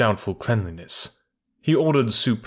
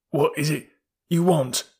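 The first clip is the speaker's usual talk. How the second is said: fearful stammer; 6 semitones higher than usual. A narrator's reading. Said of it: "stammered Victor fearfully".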